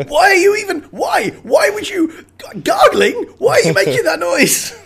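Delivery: high, loud comic outburst; exasperated disbelief. Acted. Podcaster berates Lex for gargling, exasperated shouting. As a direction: shout in baffled outrage. Acting outrage at Lex's gargling.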